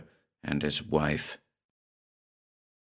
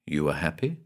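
a softer question, dropped and quieter; speaking lower; 5 semitones lower, 7 dB quieter, faster than usual. A narrator's reading. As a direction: lower the voice, ask it gently. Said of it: "speaking lower".

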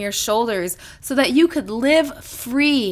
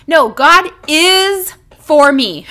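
is leaned on, slow and louder; stress on IS; 2 semitones higher, 6 dB louder, slower than usual. Shown as a demonstration. Preacher correcting doubt, stressing is. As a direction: push the word is firmly. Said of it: "The next word is is".

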